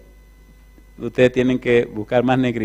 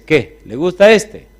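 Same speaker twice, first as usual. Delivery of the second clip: louder, higher gruff sales bark; a coarse trader pushing his goods; rough slave seller; 6 semitones higher, 6 dB louder, slower than usual. Acted. Rough slave trader, louder and higher, pushing the buyer. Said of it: "un tipo rudo".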